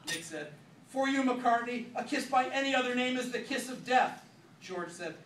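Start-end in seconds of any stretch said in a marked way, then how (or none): 0.9-4.2 melodramatic threat. Acted. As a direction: deliver it like a villain's line.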